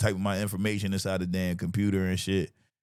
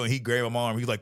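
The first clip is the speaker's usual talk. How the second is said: ordinary talk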